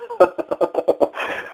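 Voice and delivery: a short breathy laugh of disbelief; incredulous laugh. Real, not acted. Scoffing at the caller who brags about sarcasm, a breathy disbelieving laugh.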